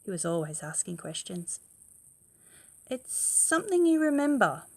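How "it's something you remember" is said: father's voice. Acted. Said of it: "said his father".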